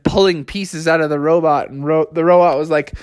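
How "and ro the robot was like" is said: plain reading voice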